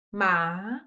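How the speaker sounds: "Ma" dipping low, then climbing; second tone. Shown as a demonstration. Hand down to start low, "Ma" rising. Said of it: "Hands down".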